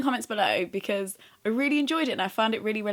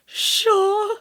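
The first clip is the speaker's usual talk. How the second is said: high, loud burst of eagerness; eager acceptance; 13 semitones higher, 9 dB louder than usual. Retold or imitated. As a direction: squeal a delighted yes, much higher and louder. Acts out eager reply to offer.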